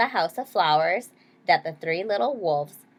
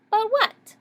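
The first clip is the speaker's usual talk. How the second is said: high, squeaky wolf question; a much higher little wolf asking; small wolves at a loss; little wolves puzzled; 13 semitones higher than usual. Acted. Wolves wondering.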